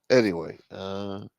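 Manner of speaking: ordinary talk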